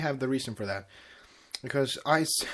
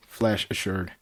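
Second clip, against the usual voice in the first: ordinary delivery, nothing marked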